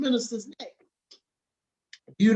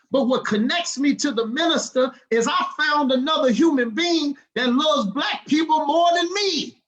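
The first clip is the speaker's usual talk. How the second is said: builds louder and higher, laughing into a shout at the end; rising fired-up testimony; 6 semitones higher, 3 dB louder than usual. Real, not acted. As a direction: let the passion break into a laughing shout. Passionate peak of his testimony.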